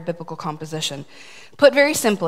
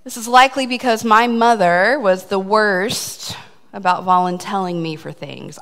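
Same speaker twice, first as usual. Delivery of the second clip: ordinary delivery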